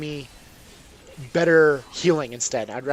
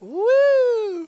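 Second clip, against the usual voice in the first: much higher, louder whoop of joy; excited whoop; 7 dB louder than usual. Real, not acted. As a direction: let out a gleeful cheer. Second good loot drop cheer.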